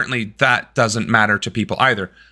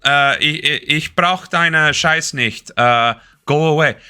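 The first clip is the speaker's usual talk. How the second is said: English and German in a German accent. Shown as a demonstration. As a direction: put on a halting German accent. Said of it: "German accent, boom, fair game".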